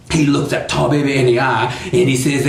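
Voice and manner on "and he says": ordinary delivery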